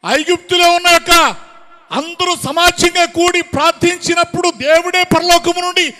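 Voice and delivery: shouted rhetorical questions from the pulpit; full-force preaching. Real, not acted. Preacher demanding what became of their prayer and unity, full-force preaching. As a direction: hammer each question at the congregation.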